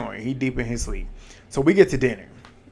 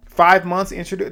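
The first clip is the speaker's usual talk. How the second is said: louder, higher, stunned at the number; shocked outburst; 6 semitones higher, 5 dB louder than usual. Real, not acted. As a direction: blurt it out in disbelief. Shocked at five months dating.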